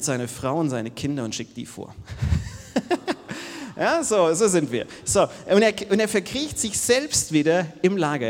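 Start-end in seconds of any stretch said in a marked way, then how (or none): 2.0-3.7 laugh. Real, not acted. Laughs at Jakob's cowardice. 3.7-8.3 loud, higher, laughing through the words; laughing loud aside. Real, not acted. Preacher jokes to the congregation about men, loud and laughing.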